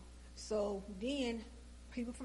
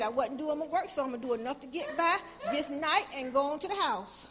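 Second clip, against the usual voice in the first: loud retort; 4 semitones higher, 9 dB louder than usual. Retold or imitated. Quoting boss in heated exchange.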